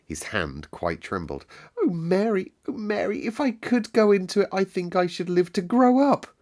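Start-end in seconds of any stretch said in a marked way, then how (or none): 1.8-6.3 put-on high, pleading boy, voice lifting with hope; cling to each word like a lifeline; breathless hope. A narrator's reading. Sick boy begs Mary for the garden, high and hopeful.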